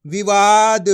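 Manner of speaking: विवाद as one word, loud on the long वा; blended together. Shown as a demonstration. Teacher reading the combined word to learners, विवाद blended. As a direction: join it up into विवाद, stretching the middle. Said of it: "तो मिलके बन जाएगा".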